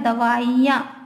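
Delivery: दवाइयाँ slow, each syllable clear, short "i"; slow careful davaiyan. Shown as a demonstration. Teacher modelling the correct way: slow, careful दवाइयाँ. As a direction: spell out दवाइयाँ slowly with a short i. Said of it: "छोटी ई का उच्चारण".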